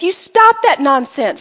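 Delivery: normal talking voice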